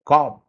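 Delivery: "Calm" ending on m, no b; silent B. Shown as a demonstration. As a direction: demo word "Calm" closed on the m. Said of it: "We don't say b".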